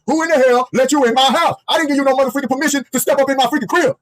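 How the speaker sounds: high-pitched, acted outrage; indignant demand. Husband confronting maintenance man in his house, acted outrage. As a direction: play an offended householder challenging an intruder.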